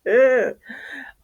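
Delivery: a bright laugh, far up in her range; high laugh. Real, not acted. Laughing to her viewers after a joke, high laugh. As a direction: burst out laughing, pitched high.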